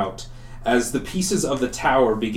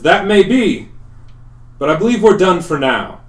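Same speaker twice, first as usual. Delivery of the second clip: louder, higher-pitched in-character reply; raised voice of a confident spellcaster; commanding rescuer; 6 semitones higher, 7 dB louder than usual. Acted. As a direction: answer the monster with raised, firm voice. Elios answers the monster's threat.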